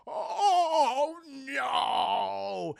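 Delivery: long, drawn-out mock wail; hammed-up cry of dismay. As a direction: stretch it out in comic horror. Boss sees his bet lost.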